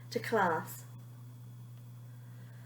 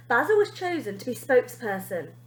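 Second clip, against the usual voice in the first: ordinary delivery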